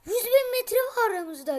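high, loud gamer outburst; heated protest. Real, not acted. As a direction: shout it across at the opponent. Protesting loudly in game fight. (hararetli, yüksek sesle itiraz)

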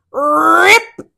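usual speaking voice

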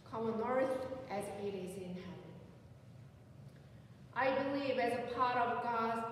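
ordinary talk, nothing marked

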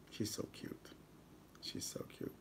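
fond whispered aside. Real, not acted. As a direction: whisper it softly, almost to yourself. Fond aside about his wife.

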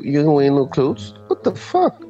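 usual speaking voice

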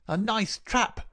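very high-pitched angry cry; high snarling voice. A narrator's reading. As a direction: snarl it up high, trapped and furious. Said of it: "cried the high, snarling voice".

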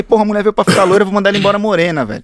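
fast, rattled inner panic; panicked thought. Retold or imitated. Hairdresser fearing he'll send her home brunette, fast mock panic. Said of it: "eu falei".

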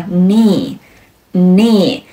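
"knee" drawn out as "nee"; silent K. Shown as a demonstration. Teacher recalling the example word, "knee" with silent K. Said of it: "开头的K通常是不发音的".

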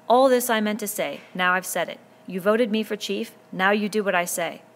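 loud, authoritative chief laying down the law; commanding shout. Acted. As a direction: stand tall and command them. Ralph commanding from the trunk.

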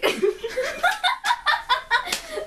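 squealing laugh; high burst of laughter. Real, not acted. Breaks up laughing.

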